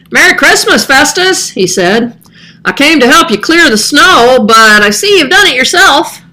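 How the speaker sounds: put-on a boy greeting the old man brightly; young neighbor boy. Neighbor's son Axel speaking.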